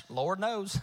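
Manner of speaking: put-on low Southern country accent; backwoods Southern drawl. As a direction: answer like a South Carolina backwoodsman. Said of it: "our thickest Southern draw accent".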